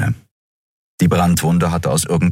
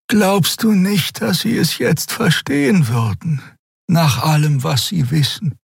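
gentle pleading Xea; 12 semitones higher than usual. A narrator's reading. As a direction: coax him to open up. Xea voiced gently pressing Kero.

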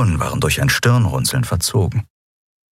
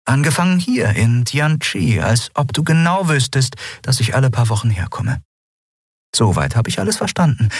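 put-on higher young woman's voice, measured and probing; Lien questioning; 7 semitones higher than usual. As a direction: lay out the facts coolly, pressing for an answer. Lien stellt Endriel zur Rede.

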